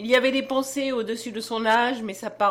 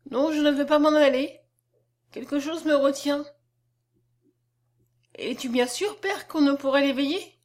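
lightly voiced child, higher-pitched; insistent little girl; 5 semitones higher, 3 dB louder than usual. A narrator's reading. Little girl refusing to leave the dead man, insistent. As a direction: resist and question as a small girl. Child figure in narrated story.

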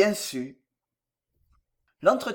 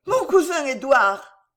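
high, loud, drawn-out cry; excited announcement; 7 semitones higher, 5 dB louder, slower than usual. A narrator's reading. Daughter announcing cousin to her father, calling out. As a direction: call out the news slowly and high.

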